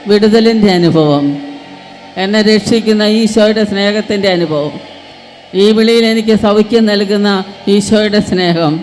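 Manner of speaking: full-force preaching. Acted. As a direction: stir the crowd to cry out.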